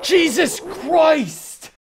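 high, loud, drawn-out fright; startled cry. Real, not acted. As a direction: yelp it out as the screen flashes. Startled by screen change.

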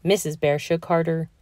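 plain reading voice

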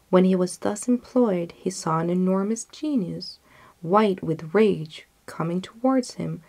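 ordinary delivery

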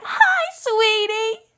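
very high, loud and very slow squeaky greeting; Harley Quinn voice. Retold or imitated. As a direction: drag out a sweet squeaky hi. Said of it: "So it's like".

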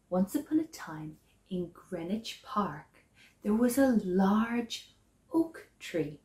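usual speaking voice

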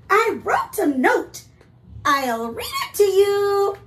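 high, loud, eager goose. Acted. Goose offering to read his note, eager goose voice. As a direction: announce it proudly, high and loud. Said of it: "He said".

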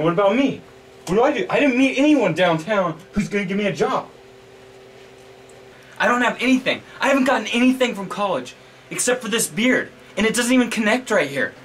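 high-pitched agitated complaint; panicked venting. Acted. Student with no job prospects venting to roommate, panicked.

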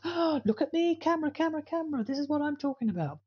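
high, showy voice playing up to the camera; mock show-off trainer. Mocking a trainer who talks to the camera, high and showy. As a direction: gush over the camera like a vain trainer.

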